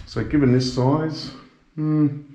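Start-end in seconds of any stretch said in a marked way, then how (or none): none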